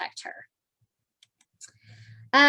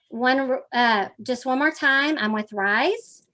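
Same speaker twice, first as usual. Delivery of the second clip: ordinary delivery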